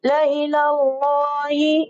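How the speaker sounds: ला इलाही with the vowel stretched long and slow; drawn-out recitation. Shown as a demonstration. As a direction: stretch the word for the students. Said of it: "तो इसको खींचेंगे". (ला इलाही खींचकर पढ़ना)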